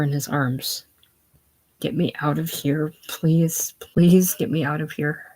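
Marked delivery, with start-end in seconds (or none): none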